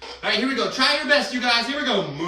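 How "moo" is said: cow moo. Pretend. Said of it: "we mooed like a cow".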